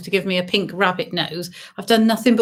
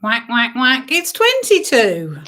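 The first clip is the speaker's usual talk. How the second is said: bingo caller; slower than usual. Acted. Calls bingo numbers.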